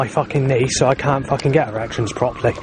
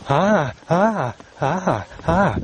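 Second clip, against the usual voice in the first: higher cawing ah ah; imitated crow caw; 6 semitones higher, much slower than usual. Retelling what the crow goes, mock crow caws. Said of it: "Crow goes".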